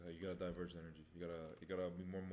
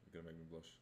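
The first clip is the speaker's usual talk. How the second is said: quieter, bashful repeat; soft and shy; 6 dB quieter, faster than usual. Shown as a demonstration. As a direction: say it shyly under your breath. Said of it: "repeats the line".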